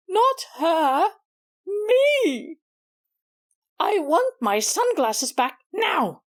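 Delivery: furious cat insisting, very cross; cross demand. Acted. Susie demanding her sunglasses back from Miss Maggie, cross. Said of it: "sounded both raspy and gruff".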